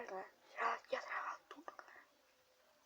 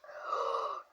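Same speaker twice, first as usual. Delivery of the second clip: startled gasp. Real, not acted. Jump scare in game.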